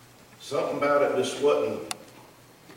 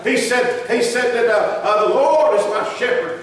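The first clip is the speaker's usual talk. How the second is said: rising preacher's fervor; preaching; 10 semitones higher, 11 dB louder than usual. Acted. Preacher telling the congregation about his faith, preaching.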